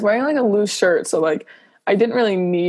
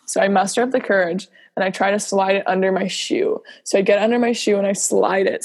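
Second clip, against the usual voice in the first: normal talking voice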